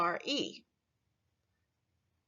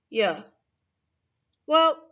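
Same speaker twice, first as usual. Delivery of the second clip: usual speaking voice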